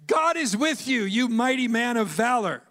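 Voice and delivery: loud, high, ringing angel voice; angel's proclamation. Acted. Angel voiced in Bible retelling.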